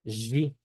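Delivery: G said as a buzzing "zhi"; voiced zh pronunciation. Shown as a demonstration. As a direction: say G like French "j" plus ee. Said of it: "Se pronuncia".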